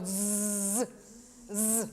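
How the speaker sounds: soft buzzing z like a mosquito. Shown as a demonstration. Teacher imitating a mosquito buzz for the Z. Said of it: "el zumbido de un mosquito".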